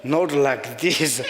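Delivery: slow, chuckled words; laughing. Real, not acted. Recalling the priest's silence, laughing. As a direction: say it through laughter.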